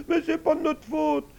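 put-on high, wailing child's plea; sobbing little girl's excuse; tearful. A narrator's reading. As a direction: whine through acted tears, pleading. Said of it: "Les deux petites filles se mirent à pleurer".

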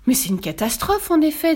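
flustered king, lightly voiced; panicky. A narrator's reading. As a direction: echo the alarm in a fluster. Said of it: "tout affolé".

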